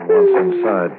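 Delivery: high, loud exclamation; sudden cry. Acted. Handler as the dog charges inside, sudden high cry. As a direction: blurt it out as the dog lunges.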